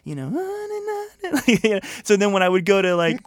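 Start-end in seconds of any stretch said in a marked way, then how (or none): 0.3-1.4 a squeaky high giggle; high falsetto laugh. Real, not acted. Laughing at his own high voice, a squeaky giggle.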